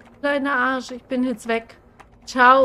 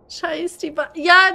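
normal talking voice

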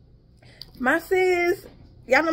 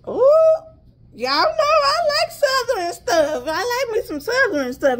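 delighted ooh; 8 semitones higher than usual. Real, not acted. To viewers loving the Southern style label, delighted. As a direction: squeal over something you love.

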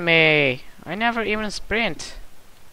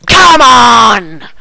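frustrated shout at the game; loud raging yell; angry yell; 6 semitones higher, 18 dB louder than usual. Real, not acted. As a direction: yell it in a gamer rage. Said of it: "I'm sorry if I'm raging".

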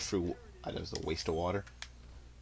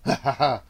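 pleased laugh at own pun; short, raised-pitch chuckle; 4 semitones higher than usual. Real, not acted.

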